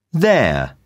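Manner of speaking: ordinary delivery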